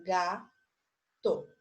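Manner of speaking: sílaba por sílaba. Shown as a demonstration. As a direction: sound out "Gato" as ga, then to. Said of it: "É uma palavra de sílaba". ("Gato" separando bem as duas sílabas)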